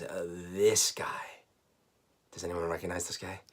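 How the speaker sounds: hushed reveal; breathless awed whisper. Acted. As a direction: whisper it like unveiling a treasure. Revealing the toy.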